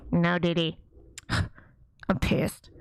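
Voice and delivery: low, subdued mock displeasure; imitating understated annoyance. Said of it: "you know he's like this".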